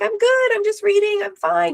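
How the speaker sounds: high cheery I'm-fine voice waving the family away; breezy fake fine. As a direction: chirp it like nothing's wrong. Brushing off family checking on her.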